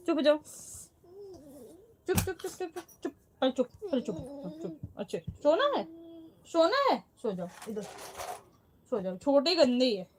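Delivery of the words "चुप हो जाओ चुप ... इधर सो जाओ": repeated hushing. Real, not acted. Mother softly shushing her daughter to stop crying. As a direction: hush her to sleep.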